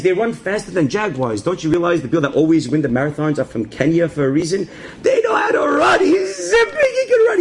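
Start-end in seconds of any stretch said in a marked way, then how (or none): 5.0-7.4 excited storytelling shout. Retold or imitated. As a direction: shout it like the chase is on. Cannibals sprinting after him.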